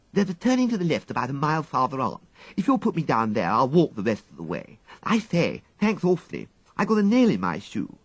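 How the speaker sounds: put-on high, fast voice of an eager twelve-year-old girl; schoolgirl Peggy. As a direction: chatter it out like a cheeky schoolgirl.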